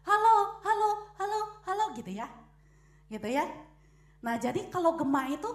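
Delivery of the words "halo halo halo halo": loud high call fading away as an echo. Retold or imitated. The well answering back, a high fading echo. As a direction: let each halo fade like a well echo. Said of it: "Nanti kedengarannya apa?".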